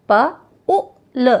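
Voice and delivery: प, उ, ल said apart, slowly; sounded out slowly. Shown as a demonstration. Teacher to children, sounding out slowly. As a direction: break पुल into its sounds slowly. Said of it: "छोटा उच्चारण होता है".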